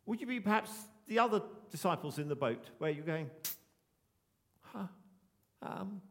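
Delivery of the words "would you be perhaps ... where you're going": ordinary delivery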